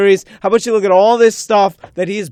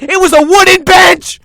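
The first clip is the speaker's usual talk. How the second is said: higher and louder, fed-up yell; exasperated yell; 7 semitones higher, 6 dB louder than usual. Real, not acted. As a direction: yell it like you've had enough. Escalating rant about the bench.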